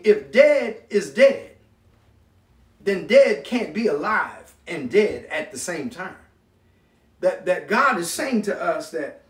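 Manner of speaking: normal talking voice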